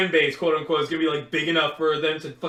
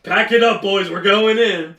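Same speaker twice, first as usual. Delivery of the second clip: hyped shout; 4 semitones higher, 9 dB louder than usual. Retold or imitated. Friends diving into the mystery.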